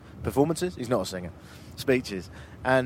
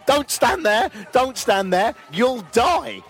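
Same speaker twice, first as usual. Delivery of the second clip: imitating the guide; 9 semitones higher, 5 dB louder, slower than usual. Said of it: "while a guide said".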